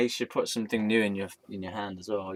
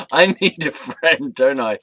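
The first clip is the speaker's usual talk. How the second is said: laughing; 5 semitones higher, 14 dB louder, slower than usual. Real, not acted. Self-mocking aside to the camera.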